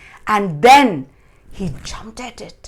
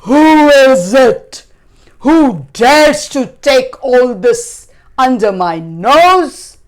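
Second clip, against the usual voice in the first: loud, raised giant's roar; thundering fury; 6 semitones higher, 14 dB louder than usual. A narrator's reading. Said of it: "He stood up and thundered".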